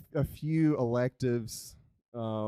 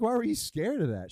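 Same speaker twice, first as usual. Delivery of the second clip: incredulous question; 8 semitones higher, 5 dB louder than usual. Retold or imitated. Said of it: "And I'm like".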